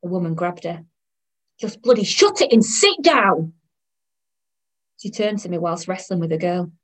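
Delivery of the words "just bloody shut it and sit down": loud, slow, raised telling-off; exasperated mother shouting; angry scolding. Acted. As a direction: snap at a child to behave. Mother grabs and scolds child.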